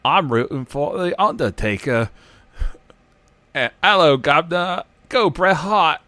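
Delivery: high, loud mock British kid accent; hammed-up British cockney kid. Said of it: "obviously thick British accents".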